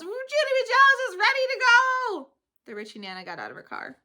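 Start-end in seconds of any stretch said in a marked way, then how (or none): 0.0-2.3 eager yell. Acted. Child announcing eagerly.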